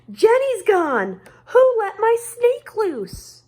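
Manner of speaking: high frantic shout about the lost snake; panicked shout. Acted. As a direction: cry out in alarm, high and loud. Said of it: "Emily shouts".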